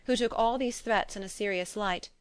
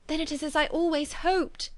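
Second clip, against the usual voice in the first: higher, eager outburst of hope; hopeful cry; 7 semitones higher than usual. A narrator's reading. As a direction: Jane bursts in with hopeful excitement. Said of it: "cried Jane".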